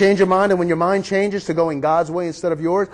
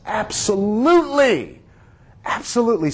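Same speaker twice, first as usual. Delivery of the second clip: preacher voicing a forgiving father; emphatic assent; 3 semitones higher than usual. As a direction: grant it wholeheartedly, stretching the first word. Forgiving father answers repentant son.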